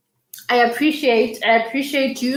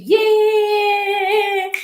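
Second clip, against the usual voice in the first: excited cheer; 10 semitones higher than usual. Real, not acted. Happy cheer reading a kind comment.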